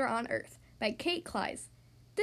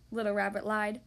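ordinary delivery, nothing marked

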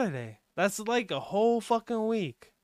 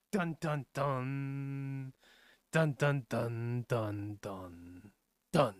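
vocal sound effect; 6 semitones lower, 6 dB quieter, much slower than usual. Pretend. Imitating intro music.

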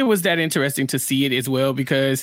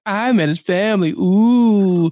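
louder, drawn-out gloating ooh; mock bragging; 3 semitones higher, 4 dB louder, slower than usual. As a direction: show off the news, hammed up. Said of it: "Instead of being like".

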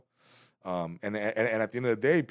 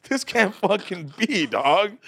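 disbelief; 11 semitones higher, 8 dB louder, much slower than usual. Retold or imitated. Disbelief after bombing.

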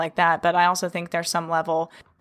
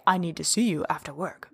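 ominous tone; 2 semitones lower, 4 dB quieter than usual. Shown as a demonstration. Boss summoning an employee, quiet and ominous. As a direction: say it like trouble is coming. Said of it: "he just said it in a plain tone, he didn't say".

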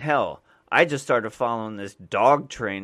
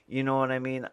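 ordinary talk, nothing marked

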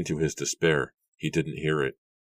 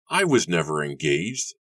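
ordinary delivery

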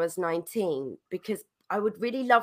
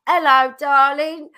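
loud, higher, drawn-out cockney greeting; cockney accent; 6 semitones higher, 8 dB louder than usual. Retold or imitated. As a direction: imitate a cockney lady calling out slowly. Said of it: "she was real cockney".

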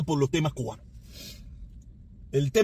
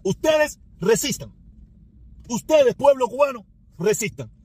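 higher, louder, slow commanding slogan; mock regime order; 5 semitones higher, 3 dB louder than usual. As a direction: bark it like the regime ordering the people.

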